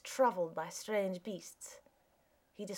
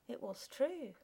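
plain reading voice

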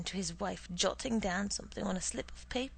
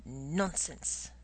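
dismissive; 4 semitones lower than usual. Acted. Husband scoffs at shopping list.

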